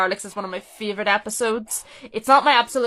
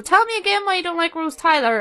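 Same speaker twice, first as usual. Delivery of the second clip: quoted line pitched higher, a touch of taunting; quoting the line; 8 semitones higher than usual. Retold or imitated. Fan quoting what others throw at her, higher and mildly taunting. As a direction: toss the quote out like a gotcha. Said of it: "People sort of use this as a".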